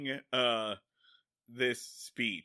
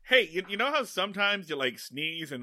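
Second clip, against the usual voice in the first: usual speaking voice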